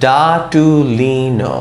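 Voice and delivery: ডাটুলিনো with every vowel drawn out; slow sounding-out. Shown as a demonstration. Teacher showing what adding O does, slow sounding-out. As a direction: stretch it evenly, DAA-TUU-LEE-NOO. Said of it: "ও দেই আমি কিরকম হয়".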